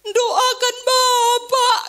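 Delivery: high, drawn-out wailing plea; acted crying, slow and high-pitched; imitated tearful plea. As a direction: stretch it out like a sobbing daughter begging. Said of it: "dia bilang sambil nangis".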